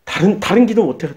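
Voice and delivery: usual speaking voice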